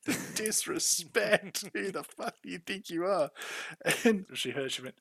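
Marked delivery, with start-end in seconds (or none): 0.1-3.3 high, loud imitation of an outraged mum; mock outrage. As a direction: scold him in hammed-up indignation. Acting out his mum's outraged scolding.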